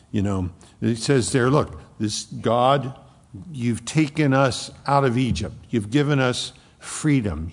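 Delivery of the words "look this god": ordinary talk, nothing marked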